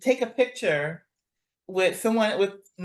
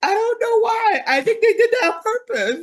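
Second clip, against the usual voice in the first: baffled exasperation; 10 semitones higher than usual. Real, not acted. Baffled about the dubbing.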